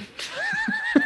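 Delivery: real squeaky high laugh. Real, not acted. Joking with the co-host about a dog who blogs, a high laugh. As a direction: crack up at the blogging dog.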